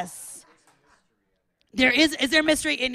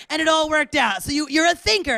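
ordinary delivery

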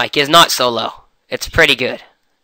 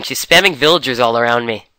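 usual speaking voice

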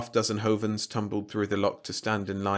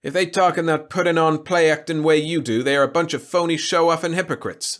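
loud, higher-pitched sulking complaint; raised, sulky grumbling at someone; sulky retort; 7 semitones higher, 7 dB louder than usual. A narrator's reading. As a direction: throw it out as an aggrieved jab. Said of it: "sulked Jacob".

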